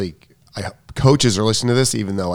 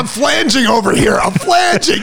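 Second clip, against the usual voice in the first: high, strained shout, much higher; stressed dad shout; 13 semitones higher, 4 dB louder than usual. Retold or imitated. Dad shouting back at the kids while assembling, strained. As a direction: yell back from mid-assembly. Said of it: "Dad, how we doing".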